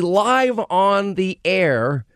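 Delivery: ordinary delivery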